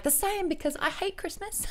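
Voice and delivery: a sarcastic put-on voice, high and soft; mock deadpan reply. Answering the Christmas shirt in a sarcastic put-on voice. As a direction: toss it off in a mock voice. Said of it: "And I was like".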